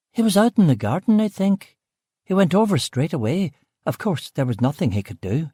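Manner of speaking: brusque West Belfast cook. Acted. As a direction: Belfast woman, short with the visitor. Aileen answers.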